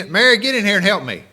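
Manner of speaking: fast, loud, high call across the house; imagined call to Mary. Retold or imitated. Martha calls Mary from the kitchen, loud and fast. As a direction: holler for her sister to come help, half-mock.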